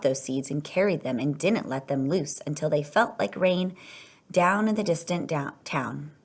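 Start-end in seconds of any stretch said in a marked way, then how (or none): none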